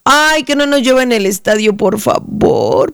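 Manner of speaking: long drawn-out "por favor"; beg, stretching the words right out; playful pleading. Pretend. Fan begging for no rain at the stadium, drawn-out mock plea. Ruega que no llueva.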